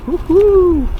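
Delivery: high, loud whoop of delight; cheering woohoo. Real, not acted. Traveller joining a crowd's cheer in the park, whooping loudly. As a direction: let out a big happy whoop.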